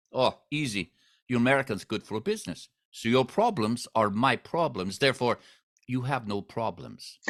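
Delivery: mobster in broken Russian English; Russian accent. Retold or imitated. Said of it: "my really bad Russian accent".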